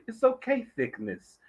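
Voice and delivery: high-pitched quote of a singer. Retold or imitated. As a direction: imitate a singer sweet-talking a lady, slowing at the end. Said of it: "talking to the lady".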